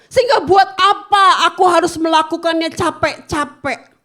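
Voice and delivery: loud, high, impassioned sermon; forceful preaching. Acted. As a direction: drive the point home to the church. Preaching to congregation.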